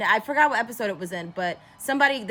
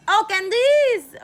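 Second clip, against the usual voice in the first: put-on high, loud, drawn-out French-accented greeting; mock French accent; 14 semitones higher, 7 dB louder, much slower than usual. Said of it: "The whole time in this French accent".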